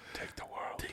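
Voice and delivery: hushed, breathy whisper. Acted. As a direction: whisper it like a threat. Whispered villain line.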